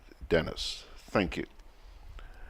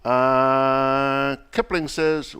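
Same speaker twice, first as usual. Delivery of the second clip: ordinary talk, nothing marked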